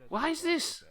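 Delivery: very high, exasperated question; baffled squeal. Real, not acted. Streamer stuck on a bolt, baffled high squeal. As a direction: squeak it out in bewilderment.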